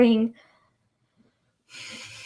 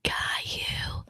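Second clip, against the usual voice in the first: creepy whisper; 8 semitones higher, much slower than usual. Acted. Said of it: "Do the thing".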